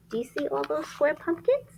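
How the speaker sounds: ordinary delivery, nothing marked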